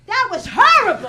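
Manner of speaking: put-on high, loud old lady verdict; grandmother's shriek. Grandmother to her comic grandchild, outraged shrill old lady. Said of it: "She goes".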